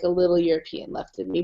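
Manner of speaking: ordinary talk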